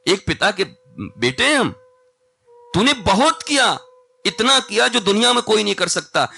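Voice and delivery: high, loud fervent katha voice; preaching at full force. A narrator's reading. Ram speaking of his brother Bharat, in loud fervent preaching. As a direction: declaim Ram's words with passion.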